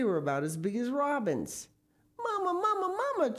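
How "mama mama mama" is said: put-on very high, birdlike child voice; little boy chirping for his mother; chirped. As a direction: chirp it like a small bird. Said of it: "chirped Philip".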